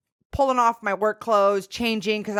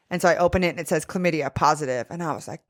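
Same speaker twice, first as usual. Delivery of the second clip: usual speaking voice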